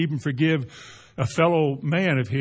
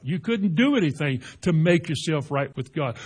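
usual speaking voice

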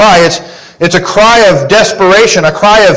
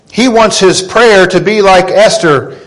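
ordinary talk

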